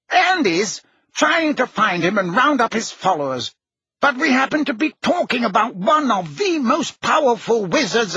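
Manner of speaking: high-pitched, exasperated self-defence; heated. A narrator's reading. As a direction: protest emphatically, pitch high all through. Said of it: "demanded Fudge heatedly".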